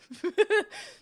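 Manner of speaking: high laughing burst; a sudden high laugh; burst of laughter. Real, not acted. Amused by the snack.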